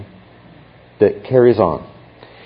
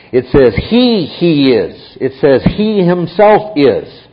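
"he himself is" said slower and higher, with "himself" punched; stress on HE HIMSELF; 4 semitones higher, 4 dB louder, slower than usual. Shown as a demonstration. Teacher stressing "he himself is" to show the Greek emphasis. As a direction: lean hard on "he" and "himself". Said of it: "there's an emphasis on He".

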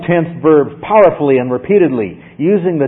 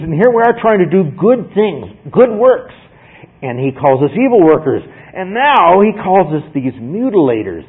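higher-pitched, mildly hammed-up indignation; indignant complaint; 5 semitones higher, 3 dB louder than usual. Judaizers complaining about Paul calling them names, indignant. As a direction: protest like the wronged party.

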